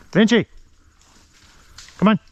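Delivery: loud call to the dog. Real, not acted. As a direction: shout the dog's name. Calling dog in the field.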